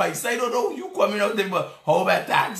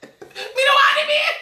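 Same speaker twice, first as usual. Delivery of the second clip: mock voice; 5 dB louder than usual. Mock voice cutting off a caller.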